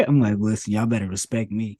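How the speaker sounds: ordinary talk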